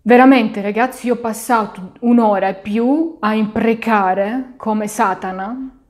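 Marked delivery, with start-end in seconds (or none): none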